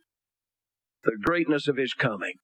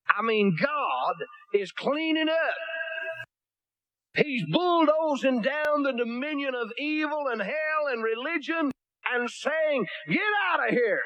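higher, slow, rising preaching fervor; high, slow, mounting sermon cry; fervent preaching; 9 semitones higher, slower than usual. Real, not acted. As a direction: lift the voice and build the fire slowly.

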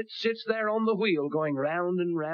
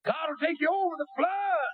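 mocking another preacher; 12 semitones higher than usual. Preacher mimics a radio preacher, mock shout. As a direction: parody the radio preacher's easy promise. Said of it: "I heard a preacher on radio the other day said".

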